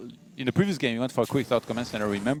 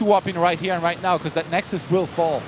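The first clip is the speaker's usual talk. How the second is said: tense excited commentary; 5 semitones higher, 5 dB louder than usual. Real, not acted. Tense late-game commentary.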